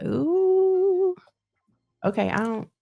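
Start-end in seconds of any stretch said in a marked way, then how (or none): 0.0-1.1 long, high-pitched "oooh"; lyric's "ooh". Acted. Reader acts out the song's reaction, high drawn-out ooh. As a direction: hold a high, swooping ooh.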